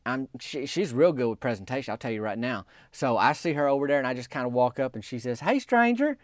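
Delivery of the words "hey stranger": playful, high-pitched hello to a friend; joking greeting. Retold or imitated. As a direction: lift it high, teasing like a mock stranger. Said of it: "it's a joke 'cause she knowed".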